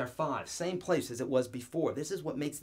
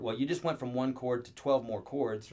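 usual speaking voice

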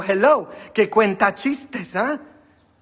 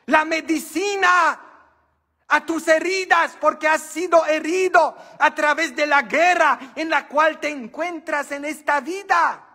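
raised, insistent preaching, pushing each phrase; preaching hard; 9 semitones higher than usual. Acted. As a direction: drive the point home to the pews. Preaching to the congregation.

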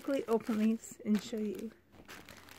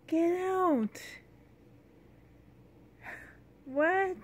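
surprised; 6 semitones higher, much slower than usual. Real, not acted. Opening a surprise gift.

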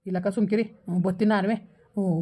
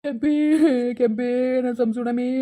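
slow, drawn-out begging; pleading wail; slower than usual. Acted. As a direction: stretch the words into a pleading cry. Husband begs wife for forgiveness.

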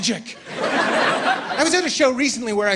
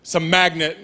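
a stranger leaning over sure he has it figured out; know-it-all guy; 2 semitones lower than usual. Retold or imitated. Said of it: "Leans over me and goes".

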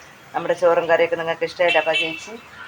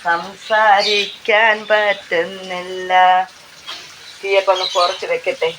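slow, dragged out with a strained throat; hoarse strained voice; 2 semitones higher, slower than usual. Real, not acted. Vlogger telling friends her voice is gone, slow and strained. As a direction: voice worn out from talking. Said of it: "സൗണ്ടൊക്കെ അടഞ്ഞു".

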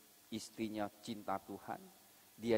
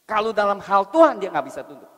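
preaching at full force; 11 semitones higher, 16 dB louder than usual. Acted. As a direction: hammer the point home from the pulpit.

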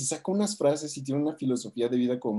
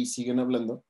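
normal talking voice

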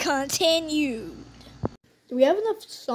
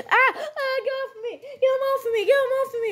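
panicked yelling; 11 semitones higher, faster than usual. Pretend. Character attacked, calling out.

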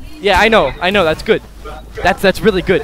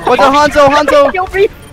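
urgent warning shout; 6 semitones higher, 4 dB louder, slower than usual. Real, not acted. Warning team of enemy Hanzo.

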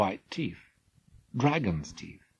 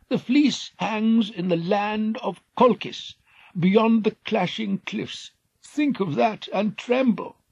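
higher, slow ominous warning; old king's warning; 12 semitones higher, 5 dB louder than usual. Acted. Old king warning Jason of clashing cliffs, ominous. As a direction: make them fear the cliffs.

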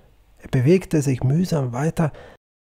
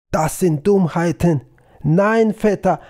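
loud indignant outcry, pitched up; calling out; 5 semitones higher, 6 dB louder than usual. Acted. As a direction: cry out against the nonsense. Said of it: "und rief". (lauter empörter Ausruf)

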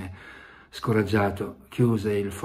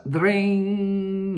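ordinary delivery, nothing marked

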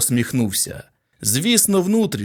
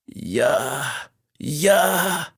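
breathed out; much slower than usual. A narrator's reading. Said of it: "видихнув Фелдман".

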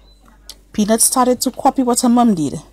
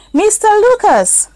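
high, slow call across the yard; 13 semitones higher, 3 dB louder than usual. Acted. Little girl calls out to the old neighbour. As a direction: call out to him and wave. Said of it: "Peanut called and waved".

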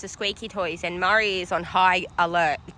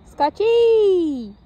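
high, loud call across the yard; loud, higher drawn-out call to the zooming dog; 11 semitones higher, 8 dB louder than usual. Real, not acted. Calling the running dog.

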